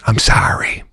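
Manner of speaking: breathy whisper, barely voiced; hushed, voiceless sorry; whispered apology. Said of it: "I go".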